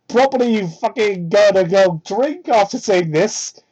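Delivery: ordinary talk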